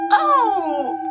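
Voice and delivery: sudden high, loud oh; surprised yelp; startled gasp. Real, not acted. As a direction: caught off guard. Startled, then laughing.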